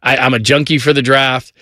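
ordinary talk

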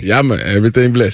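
low, laid-back Jamaican lilt; Jamaican accent. Shown as a demonstration. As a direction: say it island-style, Jamaican patois. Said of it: "the way you're saying it".

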